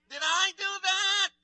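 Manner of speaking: high, nasal-free squeaky nerd voice with a laugh; Steve Urkel impression. Comedian reprising the nickname he got as a kid, in a high squeaky catchphrase voice. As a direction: pitch it way up like the sitcom nerd. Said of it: "They used to call me Steve Urkel".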